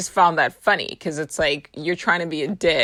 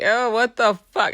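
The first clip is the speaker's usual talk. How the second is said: mock grossed-out reaction; 7 semitones higher, 4 dB louder than usual. Acting own disgusted reaction in dream.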